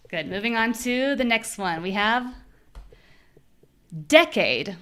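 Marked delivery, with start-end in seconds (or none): 4.1-4.8 strong first syllable in "decade"; first-syllable stress. Shown as a demonstration. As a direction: punch DEC in "decade", drop "ade". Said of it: "make the syllable that is strong sound strong".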